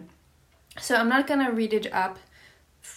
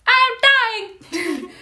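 high, slow, loud hammed-up dying cry; dying Polonius; 13 semitones higher, 10 dB louder, much slower than usual. Stabbed Polonius hamming up his death, high and slow. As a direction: stretch out a mock death wail.

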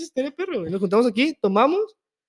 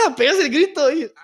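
ordinary delivery

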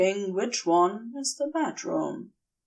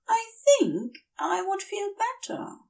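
Aziraphale voice; 12 semitones higher than usual. Acted. Aziraphale's dialogue, higher voice.

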